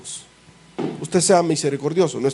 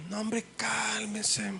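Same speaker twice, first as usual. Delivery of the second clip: soothing plea; 6 semitones higher, 14 dB quieter, much slower than usual. Acted. Soothing an angry man.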